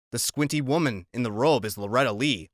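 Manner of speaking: ordinary delivery, nothing marked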